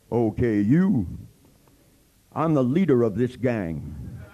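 slow, loud tough-guy threat; hammed-up tough guy in a tutu; tough gang leader. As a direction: square up like a gang boss.

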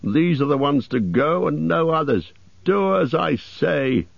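mildly cross acted command; growled order. A narrator's reading. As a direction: insist testily, slowing on the last order. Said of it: "growled the butler".

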